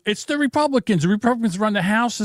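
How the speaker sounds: mock their excuse; mocking Democrats. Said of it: "they're saying".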